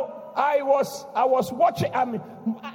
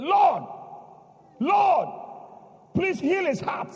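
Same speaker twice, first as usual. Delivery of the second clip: fervent prayer; 7 semitones higher, slower than usual. Retold or imitated. Pastor reenacts praying over the heartbroken brother, fervently. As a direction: cry out to God for him.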